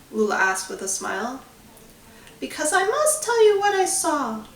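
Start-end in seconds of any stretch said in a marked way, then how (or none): 2.4-4.5 put-on much higher old woman voice, earnest; an old ghost insisting gently; high, pleading grandma ghost; old lady ghost. A narrator's reading. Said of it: "replied the old lady ghost".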